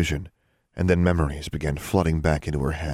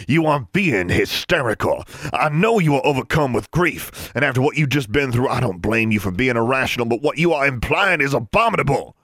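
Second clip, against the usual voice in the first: loud, raised, outraged voice; thundering rebuke; 9 semitones higher, 6 dB louder than usual. A narrator's reading. Father rebuking his hysterical daughter, thundering. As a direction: overpower her with a stern rebuke. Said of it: "His voice thundered over hers".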